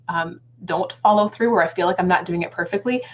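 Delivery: usual speaking voice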